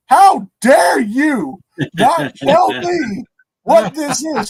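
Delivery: high, loud, slow mock indignation; "How dare you" stretched high and loud; mock outrage. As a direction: drag out the outrage. Outraged reply to dad's reveal.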